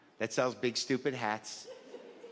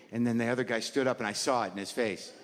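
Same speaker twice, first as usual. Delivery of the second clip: ordinary talk, nothing marked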